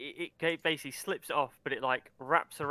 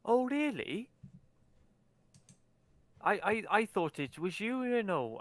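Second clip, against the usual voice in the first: high, surprised and innocent; mock surprise; 7 semitones higher than usual. Halfling feigns surprise.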